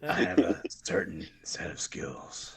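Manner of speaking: slow, low menacing whisper; Liam Neeson voice. Retold or imitated. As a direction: whisper it like a calm threat. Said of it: "You Liam Neeson them?".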